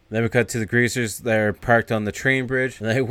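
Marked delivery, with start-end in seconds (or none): none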